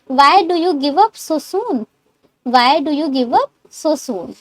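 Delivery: very slow, each word separate; slow for repeating. Shown as a demonstration. Teacher asks class to repeat after her, slow and clear. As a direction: say it slowly so they can repeat. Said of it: "मेरे साथ repeat करते रहिए".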